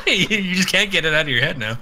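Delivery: words spilling out through a laugh. Real, not acted. Teasing his co-host, speaking through real laughter. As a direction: chuckle your way through the line.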